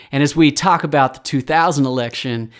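ordinary talk, nothing marked

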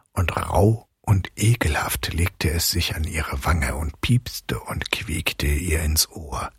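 soft, eerie storytelling whisper; creepy whispered telling; whispered narration. A narrator's reading. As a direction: hush it like a ghost story. Eerie night passage whispered.

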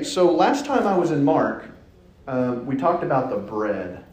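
ordinary talk

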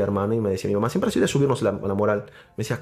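ordinary talk, nothing marked

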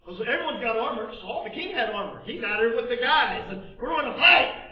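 high, loud, driving sermon delivery; preaching at full force. Acted. Preacher to congregation, full-force preaching. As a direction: drive it home to the congregation. Preacher raises voice describing army.

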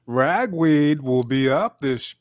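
slow, high, loud mock weatherman; pollen forecast announcer. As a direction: read it like a pollen report. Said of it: "nah, I'm just playing".

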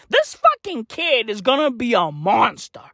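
normal talking voice

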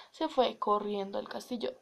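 ordinary delivery, nothing marked

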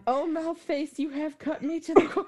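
high, laughing mock-hurt protest; mock wounded. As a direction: ham up being cut to the core. Playful mock hurt at cohost.

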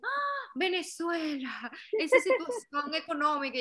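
high gasp, then stretched-out exclamation; exaggerated high-pitched dismay, imitating others; mock shocked reaction. Foreigner reacting to hearing Venezuela, mock shocked.

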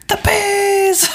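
very high, drawn-out mock announcement; Oprah Winfrey. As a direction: imitate a talk-show host unleashing a surprise. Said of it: "that gif of her with the bees".

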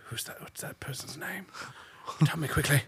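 hushed panicky whisper; urgent whisper. Retold or imitated. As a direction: whisper it urgently to your assistant. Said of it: "And I'm like".

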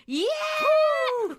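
long, high, loud whoop; crowd cheer. Acted. Audience cheering the staffer's announcement, whooping. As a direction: whoop like an audience at a live show. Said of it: "と歓声が上がりました".

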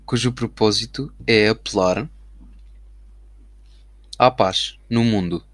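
slow dictation, word by word; slow dictating. Shown as a demonstration. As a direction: dictate it slowly as you type. Dictating the message while typing.

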